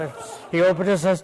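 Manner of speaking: normal talking voice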